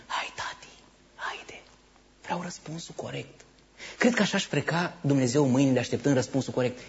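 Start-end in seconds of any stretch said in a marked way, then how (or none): 0.0-3.4 eager hushed whisper; mock anxious whisper; whispered inner plea. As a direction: whisper it to yourself, hoping. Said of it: "înăuntru tău spune".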